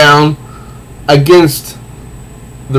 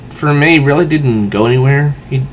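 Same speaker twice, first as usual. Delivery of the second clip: ordinary delivery, nothing marked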